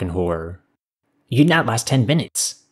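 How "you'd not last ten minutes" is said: protesting. A narrator's reading. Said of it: "the navigator protested".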